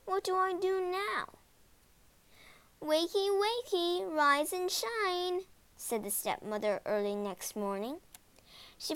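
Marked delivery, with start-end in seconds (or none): 0.1-1.4 put-on high, anxious child's question; worried boy. Hansel finds the door locked. 2.8-5.4 high, drawn-out singsong; singsong wake-up call. Acted. Stepmother wakes the children.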